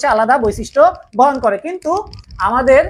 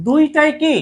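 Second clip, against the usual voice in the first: ordinary delivery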